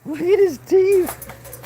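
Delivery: drawn-out coo at the puppy. Real, not acted. High slow coo at dog.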